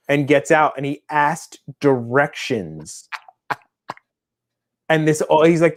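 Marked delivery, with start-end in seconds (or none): none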